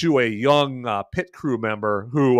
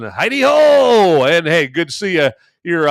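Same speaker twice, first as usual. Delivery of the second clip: louder, higher, stretched-out hello; excited greeting; 4 semitones higher, 7 dB louder than usual. Real, not acted. Streamer hails a viewer in chat, excited greeting. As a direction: call out a big cheery welcome.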